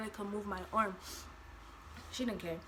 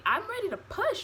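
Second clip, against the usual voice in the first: high-pitched, exasperated insistence; urgent insistence; 10 semitones higher than usual. Retold or imitated. Labouring mother repeating herself to the nurse, urgently insistent. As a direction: retell it pitched right up, demanding to be believed.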